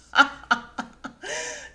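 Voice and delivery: high, airless burst of laughter; breathy laugh. Real, not acted. Host laughing at the strip-club line, wheezing laugh.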